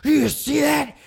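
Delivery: announcer bellowing about the Undertaker's eyes; hyped announcer. Retold or imitated. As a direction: yell it like a stunned ringside commentator. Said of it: "the announcers would be like".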